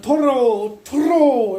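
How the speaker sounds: loud, high-pitched repeated holler; retold yelling. Still taunting the man by the bus stop, yelling. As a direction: keep hollering the taunt at full volume. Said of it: "And I'm still yelling".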